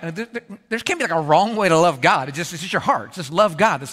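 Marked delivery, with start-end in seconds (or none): 0.0-2.3 a doubting congregant, lightly voiced; skeptical listener. Pastor voicing a listener's doubt, lightly mocking. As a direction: voice the doubter's quick objection. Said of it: "a feeling like".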